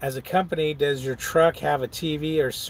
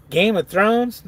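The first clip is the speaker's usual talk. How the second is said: incredulous question; 6 semitones higher, 4 dB louder, slower than usual. Real, not acted. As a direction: pitch jumps up high on Thrones in disbelief. Incredulous repeat of the title.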